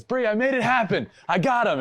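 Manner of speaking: imitating a gleeful brag; triumphant. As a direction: crow about the win. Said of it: "and goes".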